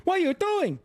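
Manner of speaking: high, emphatic Cantonese Hong Kong accent. Shown as a demonstration. Streamer demonstrating comedians' accent, high Cantonese Hong Kong English. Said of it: "more of a Cantonese Hong Kong style accent".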